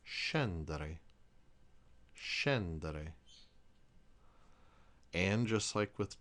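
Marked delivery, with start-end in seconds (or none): 0.1-1.0 Italian sc before e as sh; Italian pronunciation. Shown as a demonstration. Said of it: "the word S C E N D E R E is". 2.1-3.1 Scendere repeated with sh sound, SHEN-deh-reh; Italian sc as sh; Italian pronunciation. Shown as a demonstration. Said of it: "the word S C E N D E R E is".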